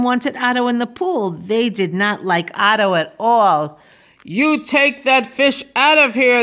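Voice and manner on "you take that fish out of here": moderately raised, higher-pitched yell; angry crowd's yell. A narrator's reading. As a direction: order it out with a mild yell. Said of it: "they yelled at me".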